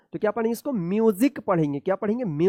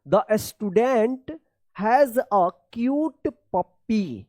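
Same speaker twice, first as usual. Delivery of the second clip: The student has a cute puppy read word by word, very slowly; slow reading aloud; much slower than usual. Shown as a demonstration. Teacher reading a sentence to pupils, very slow. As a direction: spell out each word slowly for beginners. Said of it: "तो देखिए यहाँ पे".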